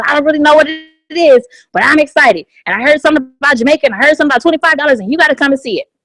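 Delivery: fast, bubbly rush of excitement; eager invite rattled off to a friend; excited invite. Shown as a demonstration. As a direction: gush it out, tumbling over the words. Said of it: "with enthusiasm and excitement".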